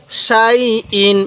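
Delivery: সাই ইন read very slowly, both syllables drawn out; slow drawn-out recitation. Shown as a demonstration. Teacher reciting সাই ইন to students, slowly drawn out. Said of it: "কীভাবে পড়ব".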